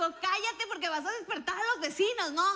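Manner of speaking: laughing high scold; mother hushing her, retold laughing; mom's scolding. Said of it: "me dijo".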